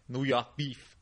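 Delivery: Bronx keyword, New York dropped r; Bronx accent. Shown as a demonstration. Showing the supermarket keyword in a Bronx accent. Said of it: "uh, a Bronx accent".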